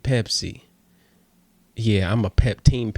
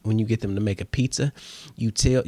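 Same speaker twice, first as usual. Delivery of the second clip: normal talking voice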